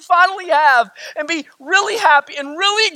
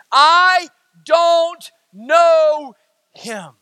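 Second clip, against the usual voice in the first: loud, drawn-out word by word, then dropping on the last; emphatic denial; 2 semitones higher, 4 dB louder, much slower than usual. Retold or imitated. Preacher voicing Peter denying Jesus, emphatic denial. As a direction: imitate a forceful denial, stretching each word hugely.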